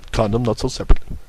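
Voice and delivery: quick ad disclaimer voice; fast disclaimer. Acted. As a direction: rattle off the small print.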